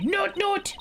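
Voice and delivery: high cartoon penguin honk; squeaky penguin call; penguin noot noot. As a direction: pitch it way up like a silly penguin. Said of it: "every time I see that penguin".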